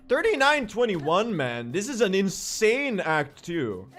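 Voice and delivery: high, swooping excited delivery; amazed excitement. Real, not acted. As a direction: marvel at a record pace. Amazed at own fast run time.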